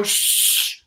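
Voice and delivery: long "shh" to quiet a crowd; imitated shushing. Host retelling his bad-mood moment, shushing. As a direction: shush everyone beyond the doors. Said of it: "just shush everybody".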